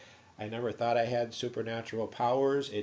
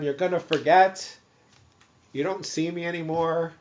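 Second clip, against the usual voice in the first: louder, emphatic pronouncement; retold insistent command; 4 semitones higher, 6 dB louder than usual. Patient telling guards to forget him, insistent. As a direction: insist on it firmly. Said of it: "I kept telling them".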